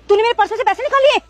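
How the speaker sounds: angry accusation. Acted. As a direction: hurl the accusation at her. Employer accuses maid of theft. (गुस्से में इल्ज़ाम लगाना)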